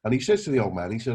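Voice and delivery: ordinary talk, nothing marked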